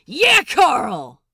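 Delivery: loud, excited "Yeah, Carl!"; cheering shout. Acted. Crewmate cheering for Carl, excited. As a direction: whoop it out.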